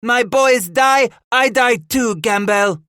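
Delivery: very high, loud defiant reply; yelling. A narrator's reading. The matriarch answers Gamble from the stockade, yelling. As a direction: yell back, hammering each phrase. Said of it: "she yelled in reply".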